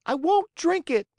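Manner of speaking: very high, loud tantrum shout; shouting child. A narrator's reading. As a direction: scream the refusal like a spoiled boy. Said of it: "cried Pinocchio".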